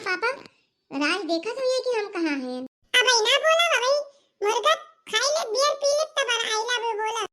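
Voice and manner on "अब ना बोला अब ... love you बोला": put-on teasing girlfriend.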